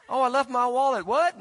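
high, loud hammed-up panic over a wallet; mock forgetful leaver. Preacher hamming up a churchgoer who rushes off, high loud mock panic. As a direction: play a scatterbrain rushing back in. Mock skit of hurried leaving.

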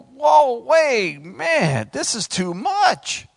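high, loud protest slowing at the end; mock panic, drawn out; overwhelmed Gideon. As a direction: balk like an overwhelmed recruit. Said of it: "see Gideon going".

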